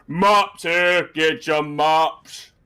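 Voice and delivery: loud, drawn-out street cry with altered vowels; put-on vendor voice, hawking slowly; second map hawker. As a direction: call out wares with long, stretched words. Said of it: "so you see another one".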